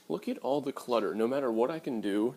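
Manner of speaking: ordinary talk, nothing marked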